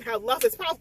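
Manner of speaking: ordinary delivery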